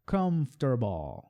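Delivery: comfortable slowed, three syllables kuhmf-ter-bl; slow model word. Shown as a demonstration. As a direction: break comfortable into three beats. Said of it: "três sílabas".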